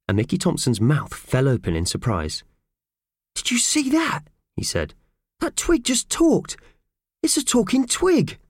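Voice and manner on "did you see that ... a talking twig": astonished. Acted. Mickey amazed twig talks.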